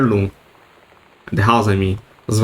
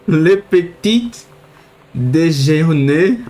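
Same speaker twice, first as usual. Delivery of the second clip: attempted French accent; 4 semitones higher, slower than usual. Shown as a demonstration. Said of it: "proper accent".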